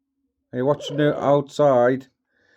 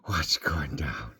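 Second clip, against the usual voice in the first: whisper; 11 semitones lower, 8 dB quieter, slower than usual. Real, not acted. Whispering to the parrot.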